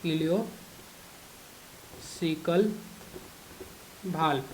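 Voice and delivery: Ileocaecal, long pause, then valve, said slowly and quietly; slow, spaced out. Shown as a demonstration. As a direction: dictate the term word by word, leaving gaps for note-taking. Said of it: "जिसको हम लोग बोलते हैं ileocaecal valve".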